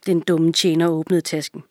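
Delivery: usual speaking voice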